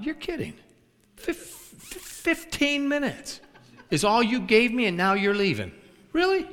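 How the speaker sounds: high, incredulous "you're kidding", stretching the stutter; the preacher is stunned the woman walks out mid-sermon; incredulous disbelief. Retold or imitated.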